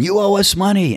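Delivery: high-pitched mock yell; retold yelled demand; Clyde yelling. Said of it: "Clyde's yelling".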